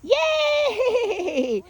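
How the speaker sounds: long high whoop of praise; cheering. Real, not acted. Trainer cheering the dog's success, cheering. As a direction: draw out a delighted, high yay.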